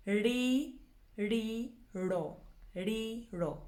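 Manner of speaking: "Ri" and "ro" sounded out separately with rolled r; broken into syllables. Shown as a demonstration. Teacher breaking a tongue twister down, "Ri" and "ro" drilled separately. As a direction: drill "Ri, ri, ro" one syllable at a time. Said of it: "if you break it down".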